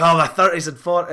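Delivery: imitating a friend in a thicker Scottish accent. Quoting friend Archie to a podcast cohost, thick Scottish accent. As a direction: lay the Scottish accent on thick, playful retelling. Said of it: "that thicker Scotch accent that you've given to them".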